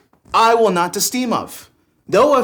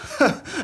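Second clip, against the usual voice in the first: laugh; 11 semitones higher, 4 dB quieter than usual. Acted. Laughs at friend's opinion.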